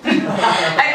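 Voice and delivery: ordinary talk, nothing marked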